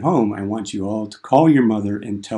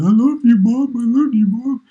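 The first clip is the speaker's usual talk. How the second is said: much higher and louder, hammed-up wailing; mock bawling; 14 semitones higher, 7 dB louder than usual. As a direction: wail it like a blubbering kid. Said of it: "a bunch of bawling idiots going".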